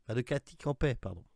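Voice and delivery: normal talking voice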